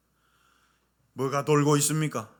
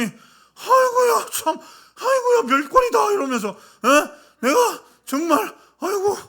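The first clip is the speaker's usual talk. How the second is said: put-on wailing old deaconess; 6 dB louder than usual. Deaconess wailing in disgust after losing her seat. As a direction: lament like an offended grandma.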